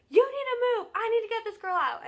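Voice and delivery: high, loud imitation of a bossy order; urgent commanding yell. Security guard pushing girls out of the way, urgent yell.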